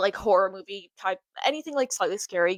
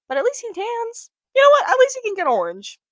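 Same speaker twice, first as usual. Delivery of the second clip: much higher, loud exclaimed "at least he tans"; high excited rant; 13 semitones higher, 8 dB louder than usual. Real, not acted. Teasing Trump's tan, high and loud with excitement. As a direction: squeal it out in mock outrage.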